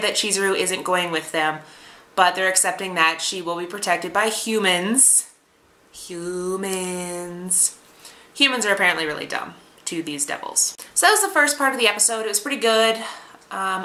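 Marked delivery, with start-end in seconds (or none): 5.9-7.8 soft, drawn-out scorn; mock disdain. Reviewer echoing devils' view of humans, quiet mock disdain.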